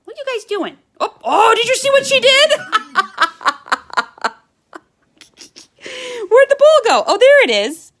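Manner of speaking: excited. Real, not acted. Birds playing fetch, delighted.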